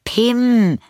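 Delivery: high, loud call; girl calls her runaway dog, high and loud. Acted. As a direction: shout the dog's name after him. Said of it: "rief Lilli".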